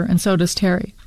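usual speaking voice

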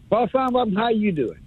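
mock caller greeting. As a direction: gush the host's name like a fan.

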